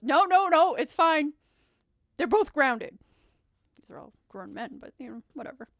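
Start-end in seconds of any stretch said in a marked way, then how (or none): 0.0-3.0 loud, high, brisk insistence; emphatic mother. Acted. Mother grounds both grown sons, loud and emphatic. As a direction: cut in firmly as a mom handing down punishment. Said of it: "And the mother goes".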